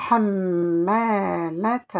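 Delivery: hammalat slow, the syllables stretched; drawn-out hammalat. Shown as a demonstration. Teacher blending hammalat for the class, slow. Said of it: "blending the word".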